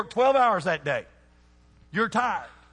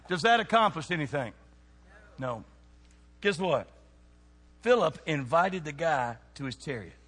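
normal talking voice

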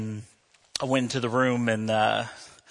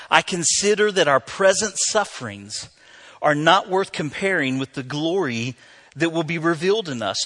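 raised, louder declamatory sermon voice; emphatic preaching; 6 semitones higher, 4 dB louder than usual. Real, not acted. Preacher reading Paul's words to the church, proclaiming emphatically. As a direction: proclaim it with deliberate, weighty phrasing.

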